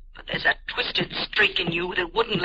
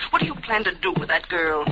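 ordinary talk, nothing marked

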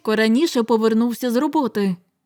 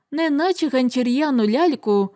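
plain reading voice

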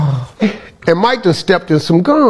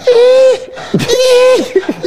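mocking whine. Mocking Mike's whining in the car, high squeaky whimper. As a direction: ham up a squeaky whine while laughing. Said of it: "Shut up all that whining".

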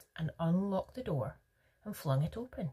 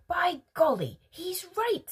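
high-pitched exclamation of wonder; amazed; 13 semitones higher, 5 dB louder than usual. A narrator's reading. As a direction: exclaim it in astonishment. Grandpa Joe marvels at the trick.

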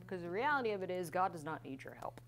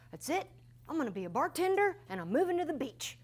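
higher and louder, fast, defiant; mock defiant declaration; 10 semitones higher, 7 dB louder than usual. Quoting her burned-out self quitting ministry, hammed-up defiance. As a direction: announce it like a fed-up quitter. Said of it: "Literally, I said that".

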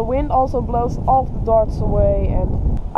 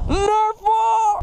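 excited shout; 9 semitones higher than usual. Real, not acted. Excited exclamation.

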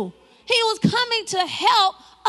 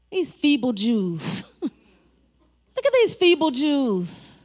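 slow, drawn-out mild taunt; mock name-calling; 5 semitones lower, much slower than usual. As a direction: taunt them lightly, stretching every word. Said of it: "they started name calling".

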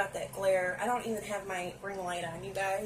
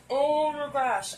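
louder, slow and drawn out with awe; thrilled gushing; 4 semitones higher, 7 dB louder, slower than usual. Real, not acted. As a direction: gush over the colors at full volume. Thrilled by the palette.

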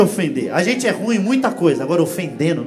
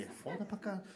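muttered grumble; 23 dB quieter, faster than usual. Retold or imitated. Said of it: "e ele".

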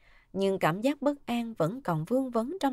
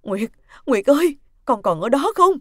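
loud, raised, anxious calling down the phone; frantic, higher-pitched appeal; panicked; 5 semitones higher, 11 dB louder than usual. Acted. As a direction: call urgently to someone who stopped answering. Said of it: "Tiếng bà Liễu vẫn hốt hoảng".

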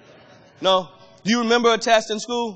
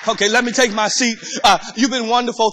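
ordinary talk, nothing marked

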